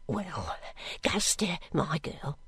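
low, soft, hesitant man's voice; uncertain. A narrator's reading. Master addressing his servant girl, at a loss. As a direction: feel for words, quietly and awkwardly. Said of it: "said he, at first not knowing what to say".

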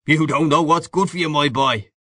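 high, swinging Irish bar-room voice; boisterous Irish man-about-town; hearty Irish old pal. A narrator's reading. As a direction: greet an old pal with breezy swagger. Old friend teases him over whiskey.

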